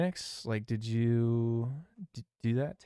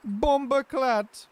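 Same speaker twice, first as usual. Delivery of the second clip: Jamaican exclamation; 12 semitones higher, much slower than usual. Acted. Exclaims in put-on accent.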